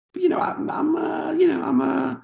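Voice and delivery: high-pitched, hesitant stammering; nervous. Acted.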